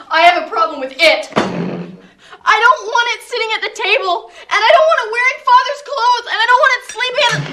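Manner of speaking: angry objection. Acted. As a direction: build from firm to heated. Angry objection in an acted quarrel.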